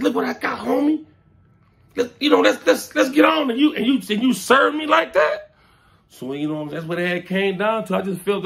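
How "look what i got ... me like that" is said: hurt pleading. Retold or imitated. Reliving betrayal, high plea.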